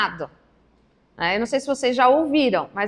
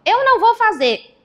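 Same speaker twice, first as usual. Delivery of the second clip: put-on defiant child; 10 semitones higher, 6 dB louder than usual. Child's defiant refusal voiced.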